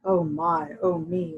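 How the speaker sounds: ordinary delivery, nothing marked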